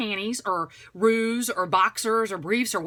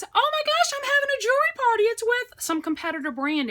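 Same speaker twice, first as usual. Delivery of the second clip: much higher and louder, gushing fast; gushing acquaintance; 13 semitones higher, 5 dB louder than usual. Pretend. Acquaintance at the bus stop announcing a party, gushing high. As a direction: gush like an overexcited friend with news. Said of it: "somebody says".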